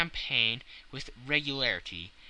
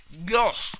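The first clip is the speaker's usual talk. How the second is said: mouth full; 7 semitones higher, 8 dB louder than usual. A narrator's reading. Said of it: "with his mouth full".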